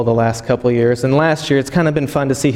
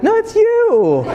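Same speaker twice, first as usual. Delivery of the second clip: put-on much higher, slow child's voice through a laugh; giggling little girl; 3 dB louder, slower than usual. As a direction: play the daughter delighted with her joke. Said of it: "She goes".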